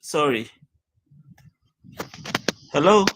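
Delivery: normal talking voice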